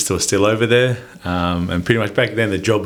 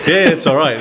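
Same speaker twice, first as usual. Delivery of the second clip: laughing; 8 semitones higher, 5 dB louder than usual. Real, not acted. Laughing off the host's joke.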